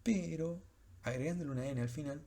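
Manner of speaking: ordinary delivery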